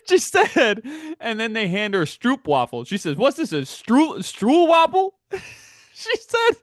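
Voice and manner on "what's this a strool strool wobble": high, puzzled voice rising on wobble; mock baffled old lady. Imitating his seatmate baffled by the stroopwafel snack, high and puzzled. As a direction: sound bewildered by a strange cookie.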